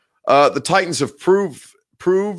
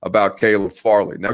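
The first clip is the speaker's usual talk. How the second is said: ordinary talk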